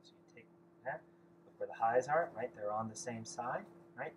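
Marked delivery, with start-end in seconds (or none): none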